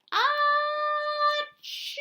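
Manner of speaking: high squeaky sneeze; hamster sneeze. Acted. Humbert the hamster sneezes on stage, high and sharp. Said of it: "said Humbert".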